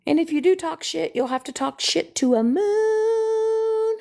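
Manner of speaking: high, slow, floaty moon voice. Pretend. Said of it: "Maybe I'll just be a rambling moon".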